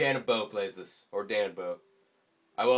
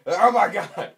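loud, higher startled yell; shout; 8 semitones higher, 12 dB louder than usual. Real, not acted. Gamer yelling as he crashes. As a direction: cry out in shock.

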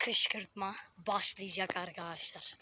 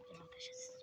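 breathless hushed whisper; 13 semitones higher, 20 dB quieter, faster than usual. Real, not acted. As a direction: whisper a warning to the viewers. Sneaking up on crying cousin.

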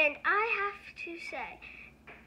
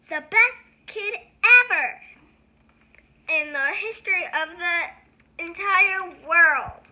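louder, gushing praise; excited praise; 2 semitones higher, 11 dB louder than usual. Pretend. Reader gushing over the kid listening. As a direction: hype up the kid.

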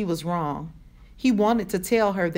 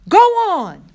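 high, very loud angry yell; furious shout; 12 semitones higher, 11 dB louder than usual. A narrator's reading. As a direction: explode at it in sudden fury. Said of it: "Rob shouted, suddenly furious".

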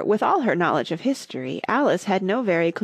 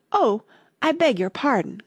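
higher, startled apology; cried apology; 5 semitones higher than usual. A narrator's reading. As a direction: catch yourself and cry sorry. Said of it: "cried Alice again".

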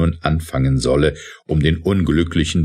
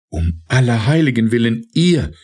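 shocked cry; 7 semitones higher than usual. A narrator's reading. Scuderi hearing the officer confess, rising shocked cry. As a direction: gasp it out in disbelief. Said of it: "rief die Scuderie".

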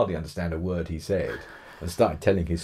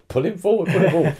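urgent pleading prayer, pitched up and louder; a friend's mock-fervent prayer, raised and loud; imitating a fervent prayer; 12 semitones higher, 8 dB louder, faster than usual. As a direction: mimic her begging the Lord to pull him forward. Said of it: "was, like, praying".

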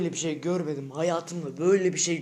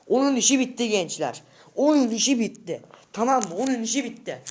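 higher, heated threat to a rival; raised-voice angry rant; angry yelling; 4 semitones higher, 4 dB louder than usual. Real, not acted. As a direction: yell it repeatedly, really worked up. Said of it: "Öyle bağırıyorum".

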